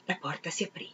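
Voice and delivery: ordinary delivery, nothing marked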